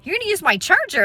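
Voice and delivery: high-pitched indignant question; incredulous. Retold or imitated. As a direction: throw it back at him in mock disbelief. Indignant retort to man wanting charger.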